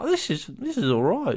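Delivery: chirpy optimism. Retold or imitated. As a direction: chirp it like a fresh morning. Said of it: "you wake up and you're like".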